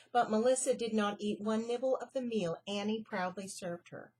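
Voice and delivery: ordinary delivery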